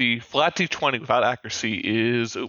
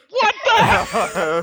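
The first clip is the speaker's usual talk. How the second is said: loud, high-pitched outburst breaking into laughter; high incredulous cry, laughing; outraged disbelief; 8 semitones higher, 6 dB louder than usual. Real, not acted. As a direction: yelp in disbelief at terrible luck. Reacts to a second bad roll.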